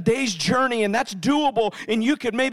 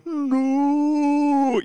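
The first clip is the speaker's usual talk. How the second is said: long drawn-out, higher mock cry of no; slow-motion no; 4 semitones higher than usual. Dad watching his guitar fall, slow-motion horror. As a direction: stretch it out as in a slow-motion replay. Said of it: "like those slow motion things".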